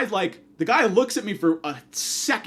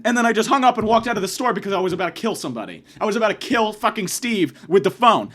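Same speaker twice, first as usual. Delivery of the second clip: normal talking voice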